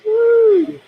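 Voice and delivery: high, loud whoop; excited whoop. Real, not acted. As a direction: let out a big whoop.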